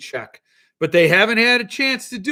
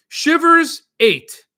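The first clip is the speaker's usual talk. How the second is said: exasperated; 11 semitones higher, 9 dB louder, much slower than usual. Real, not acted. Reading stalled order status aloud.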